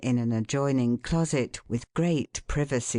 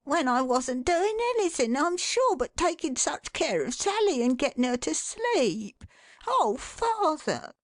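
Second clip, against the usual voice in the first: put-on whimpering boy; 13 semitones higher than usual. A narrator's reading. Son whimpering to his father in protest. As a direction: plead like a hurt little boy. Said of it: "whimpered Johnny".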